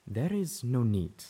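slow, solemn zebra voice; grave. Acted. Zenith answering about the tribe, gravely and slow. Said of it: "Zenith intoned gravely".

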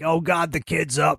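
Zach's amused morning greeting, pitched high; mock Zach. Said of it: "he was like".

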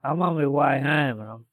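usual speaking voice

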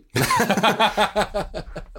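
a real laugh, pitched high. Real, not acted. Guest laughing at the joke about being bullied. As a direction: laugh at his own grim punchline.

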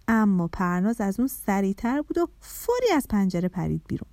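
plain reading voice

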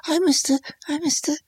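breathy imitation of the character; soft quoted line. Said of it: "trying to remember these lines he says".

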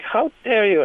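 put-on high, indignant old lady repeating herself; outraged old lady. Old lady scolding the comic, mock high outrage. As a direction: play an offended elderly woman. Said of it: "she was like".

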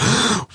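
acted gasp of alarm; sharp gasp. Dad who has lost sight of his son, mock alarmed gasp. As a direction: suck in a startled breath.

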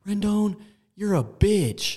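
high, slow jeering taunt; drawn-out mocking heckle; heckling fan. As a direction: jeer at him from the stands. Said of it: "some Oakland fan goes".

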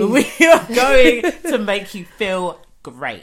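drawn-out, over-the-top upbeat announcement; hammed-up host hype. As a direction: proclaim it to the audience with a laugh. Hosts hype up the listeners.

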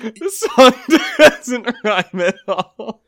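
high, loud laughing talk; cracking up mid-sentence. Real, not acted. Laughing about the song.

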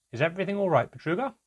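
high, deferential enquiry; respectful. A narrator's reading. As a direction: ask politely, lifting up on the name. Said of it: "The voice was respectful, reverential even".

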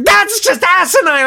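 high, loud scolding shriek; re-enacted livid victim; indignant. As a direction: berate the culprit in outrage. Victim berates prankster.

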